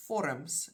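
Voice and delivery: vorms with an f sound and a schwa before the m; Afrikaans pronunciation. Shown as a demonstration. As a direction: say vorms as "FOR-uhms". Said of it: "in Afrikaans".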